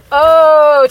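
loud, high, drawn-out oh; big self-mocking wail; exasperated groan. Real, not acted. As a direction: lament your own past stupidity aloud. Groaning at her younger self.

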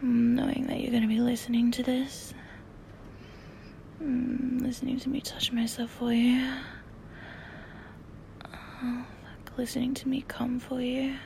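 breathy close-mic whisper. Real, not acted. Intimate whispering throughout.

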